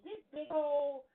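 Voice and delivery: slow, loud stressed words; emphatic. Real, not acted. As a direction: drag out each word. Mocking Jordan's size.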